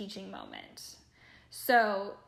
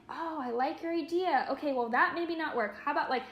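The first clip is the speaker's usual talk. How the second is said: cheering on; 5 semitones higher than usual. Retold or imitated. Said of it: "cheering them on".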